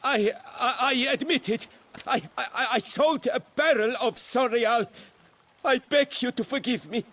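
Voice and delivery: beg, terrified of losing your head; frightened pleading. Acted. Servant stammering a confession to the duchess, frightened and pleading.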